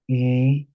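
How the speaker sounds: E held long as one steady "ee"; pure vowel sound. Shown as a demonstration. Teacher showing a monophthong, E sounded as a long "ee". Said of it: "monothems are pure vowel sounds".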